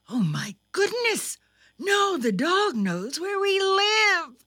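high, dismayed motherly voice; mother's alarmed cry. A narrator's reading. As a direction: cry out that the home is in danger. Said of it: "cried Thumper's mother".

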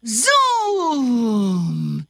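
usual speaking voice

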